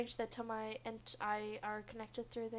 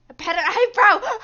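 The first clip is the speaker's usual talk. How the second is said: very loud, high cry of amusement; laughing outburst; 9 semitones higher, 22 dB louder than usual. Real, not acted. Reader cracks up at the botched idiom, laughing shout.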